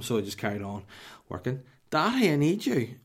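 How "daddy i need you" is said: high, loud imitation of a small child; mock little-kid wail for attention; toddler calling. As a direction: call out for Dad like a toddler. Voices two-year-old daughter calling.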